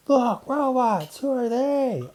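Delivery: put-on high, slow child's voice; a kid pointing in wonder. A child in the schoolyard asks about the robots, high.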